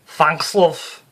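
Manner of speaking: loud, high, brassy Liverpool medium; Psychic Sue voice. Acted. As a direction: play her as a brash Scouse showwoman. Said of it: "she said".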